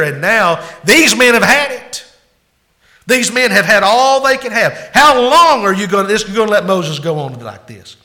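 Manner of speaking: loud, high, furious protest at the king; angry counselors. Acted. Counselors turning on Pharaoh, loud and furious. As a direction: shout it like outraged advisers.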